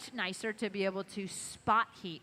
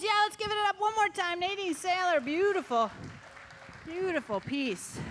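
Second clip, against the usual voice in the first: excited; 7 semitones higher than usual. Real, not acted. Host calls for applause for artist.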